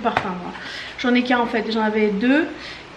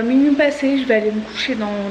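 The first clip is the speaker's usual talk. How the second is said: normal talking voice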